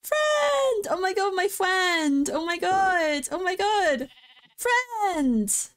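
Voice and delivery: overjoyed high calls to a friend; excited squeals. Real, not acted. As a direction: shriek with joy at the sheep. Overjoyed at finding a sheep.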